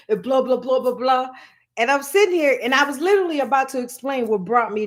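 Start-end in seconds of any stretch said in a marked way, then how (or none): none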